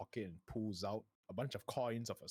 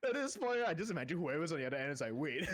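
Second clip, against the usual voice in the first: laughing; 8 semitones higher, 3 dB louder, faster than usual. Real, not acted. GM laughing while telling.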